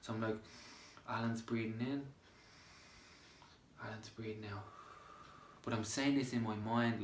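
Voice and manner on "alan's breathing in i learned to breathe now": softly murmured self-talk, as a demo; light soft voice. Shown as a demonstration. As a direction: say it lightly, as if whispering to yourself. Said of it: "with a light, soft voice".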